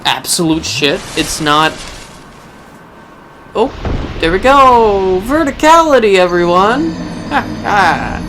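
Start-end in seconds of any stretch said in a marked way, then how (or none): 3.5-8.2 loud triumphant cheer; excited. Pretend. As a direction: proclaim it to the crowd. Triumphant mock announcement.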